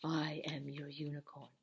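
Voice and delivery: slow, soft whisper with no voice; whispered unicorn voice. Pretend. Teacher whispering as a unicorn puppet to kids, slow whisper. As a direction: breathe the line like a secret unicorn. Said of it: "That's probably how they talk".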